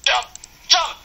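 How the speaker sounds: loud high yell of Jump!; urgent gamer shout. Real, not acted. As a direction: bark it out to hurry him. Urging the other player on.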